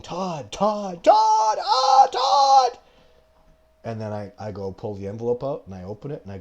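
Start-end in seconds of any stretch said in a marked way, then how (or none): 0.0-2.8 rising to very high, loud panicked shouts; imitated yelling. Expeditioner waking from the dream, yelling for Todd. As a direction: scream his name as if waking from a nightmare. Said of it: "they wake up yelling".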